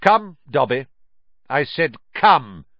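loud, impatient order; curt command. A narrator's reading. Malfoy orders Dobby to follow, loud and curt. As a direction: order a servant sharply to heel.

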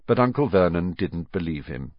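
ordinary delivery

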